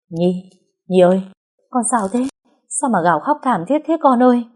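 high, worried call to a sleeper; concerned cry, pitch climbing; alarmed calling. A narrator's reading. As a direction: rouse the child urgently. Aunt calls to wake crying girl.

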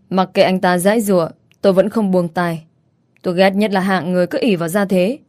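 usual speaking voice

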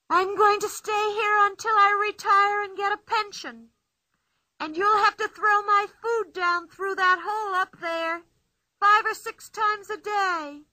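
put-on playful girl boasting from inside the tree; Pippi's voice. A narrator's reading.

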